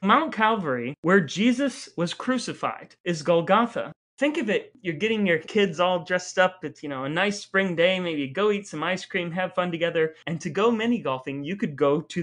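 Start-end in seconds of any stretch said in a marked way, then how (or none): none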